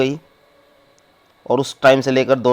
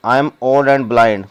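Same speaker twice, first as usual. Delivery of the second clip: each word set apart, very slow; slow reading; 4 dB louder, much slower than usual. Shown as a demonstration. Teacher reading the blind mother's line to students, slowly. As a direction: read it out slowly for the class.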